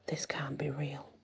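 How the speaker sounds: hushed, muttered disbelief; barely above a whisper. A narrator's reading. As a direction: mutter it very softly, almost whispering. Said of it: "his voice barely above a whisper".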